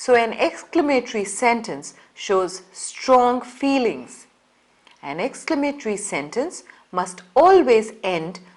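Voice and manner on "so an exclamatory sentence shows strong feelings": ordinary talk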